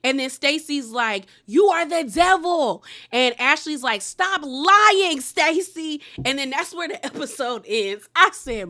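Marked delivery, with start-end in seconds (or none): 1.4-2.8 louder, higher mock accusation; hammed-up finger-pointing insult; retold accusation. Stacey hurls it at Ashley, mock angry and louder. 4.0-6.0 much higher, louder mock shout; hammed-up scolding shout; mock yell. Ashley yells at Stacey during the fight, mock loud.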